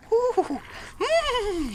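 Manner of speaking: very high playful woo; excited whoop. Real, not acted. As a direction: squeal with delight at the shot. Cheering his shot.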